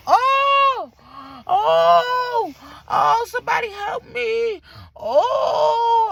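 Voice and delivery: loud, high drawn-out cries of pain; exaggerated "somebody save me" moaning; mock labor wailing. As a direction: wail for help like you're in labor, hammed up. Imitates woman in labor on stretcher.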